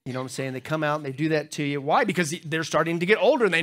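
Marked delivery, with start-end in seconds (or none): none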